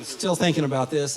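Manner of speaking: usual speaking voice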